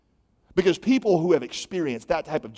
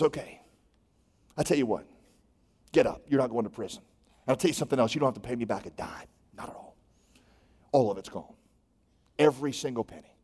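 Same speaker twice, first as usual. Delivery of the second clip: softer, with long pauses, gently forgiving; quiet, halting mercy; compassionate pardon; 5 semitones lower, 4 dB quieter, faster than usual. Retold or imitated. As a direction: let the debt go tenderly. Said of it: "he feels what Jesus calls compassion".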